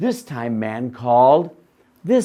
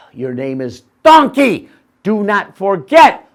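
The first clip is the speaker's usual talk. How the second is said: stern loud; 6 semitones higher, 12 dB louder than usual. Acted.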